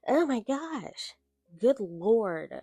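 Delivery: slow dramatic oh my gosh; exasperated. Real, not acted. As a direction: plead for the missing Christmas. Exasperated at book's tone.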